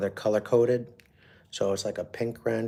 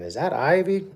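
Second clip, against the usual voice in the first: lightly voiced small child, higher; a young girl asking eagerly, a bit higher pitched; little daughter asking; 9 semitones higher, 6 dB louder than usual. Little daughter asking about dad.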